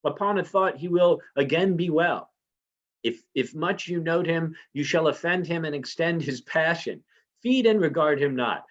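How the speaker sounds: a lightly voiced queen soothing the banquet guests; Lady Macbeth reading. Teacher reads Lady Macbeth's lines.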